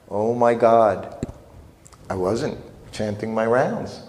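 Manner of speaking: ordinary talk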